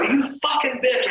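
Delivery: furious retold outburst. Confronting the woman who faked seizures.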